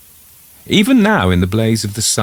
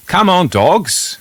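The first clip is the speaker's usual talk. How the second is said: drawn-out coaxing call, a bit louder and higher; calling the dogs; 12 semitones higher, 3 dB louder, slower than usual. Acted. Man at the wood gate calling his hesitant dogs, drawn-out coaxing call. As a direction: coax the dogs over, stretching the words.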